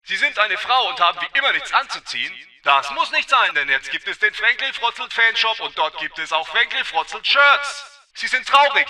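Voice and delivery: put-on upbeat old-time commercial voice; chipper 1950s ad. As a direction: sell it like a cheesy retro ad. Self-made fanshop ad read.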